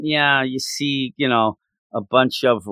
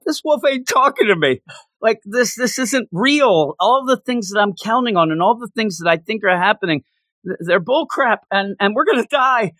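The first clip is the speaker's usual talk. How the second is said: pitched up, the truth hitting him all at once; sudden dawning realization; 6 semitones higher than usual. Retold or imitated. Man realizing the wolf never talked, rising alarm. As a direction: blurt it out as it finally clicks. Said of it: "finally the light bulb goes off".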